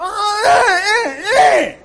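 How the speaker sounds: high loud whimpering wail; mock wail. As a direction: moan out in mock despair.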